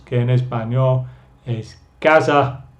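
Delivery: ordinary talk, nothing marked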